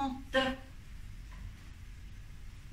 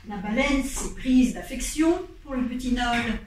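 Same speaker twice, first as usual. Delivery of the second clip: ordinary delivery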